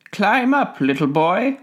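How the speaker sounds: high-pitched, coaxing tree voice; kindly tree. A narrator's reading. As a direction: coax a small child upward in a high voice. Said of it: "said the tree".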